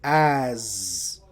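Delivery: "as" with a buzzing z. Shown as a demonstration. Teacher shows the S sounding like Z. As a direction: end "as" on a z sound. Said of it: "this S goes more like a Z".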